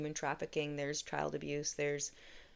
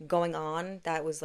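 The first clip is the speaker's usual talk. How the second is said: normal talking voice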